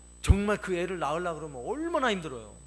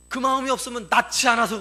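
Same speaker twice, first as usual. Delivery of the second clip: louder and higher, pressing the point; emphatic preaching; 5 semitones higher, 10 dB louder than usual. Real, not acted. Preacher rebuking teachers who don't care, in a loud emphatic voice. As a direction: make the congregation feel it.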